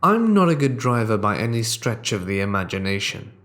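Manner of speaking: plain reading voice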